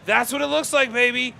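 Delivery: loud, high, thrilled; excited shouting. Real, not acted. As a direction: shout it like a fan who can't contain it. Excitedly showing pinball video.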